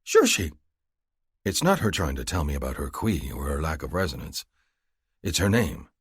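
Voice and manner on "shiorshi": much higher, happy woman's voice. A narrator's reading. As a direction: voice her pleased, high and bright. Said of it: "She gives her chest a happy pat".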